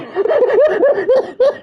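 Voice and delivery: high, breaking-up laughter; real burst of laughing; laughing fit. Real, not acted. Streamer amused at making him Irish, laughing fit. Laughs at own accent choice.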